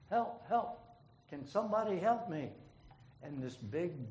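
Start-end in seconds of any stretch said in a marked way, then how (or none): 0.1-2.5 high, loud cries for help; hollering for help. A narrator's reading. Man hanging off a cliff hollering up for help. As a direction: yell up the cliff, clinging on. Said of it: "he calls out again".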